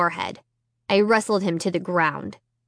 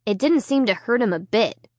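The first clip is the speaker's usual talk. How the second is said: ordinary delivery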